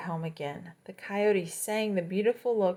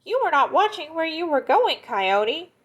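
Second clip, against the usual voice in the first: high, loud scolding; indignant gopher; 9 semitones higher, 7 dB louder than usual. Acted. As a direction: tell him off like a small fussy animal. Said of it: "the gopher is peering out".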